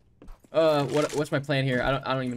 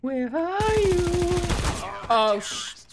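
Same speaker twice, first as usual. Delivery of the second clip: very high, slow, nervous calling out; anxious high search; tense squeak; much slower than usual. Real, not acted. Hunting last enemy, tense.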